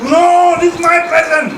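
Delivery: put-on high, loud shrieking no of a little girl; child refusing to share. Rona the daughter refusing to give her present away, high and loud. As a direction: stamp your foot like a child guarding her toy. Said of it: "This is what Rona is going to say".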